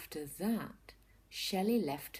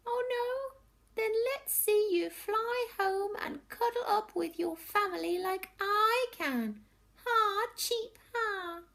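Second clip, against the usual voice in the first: taunting bird; 6 dB louder than usual. Acted.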